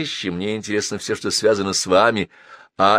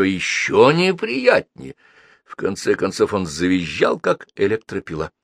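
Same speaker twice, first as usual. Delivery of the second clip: plain reading voice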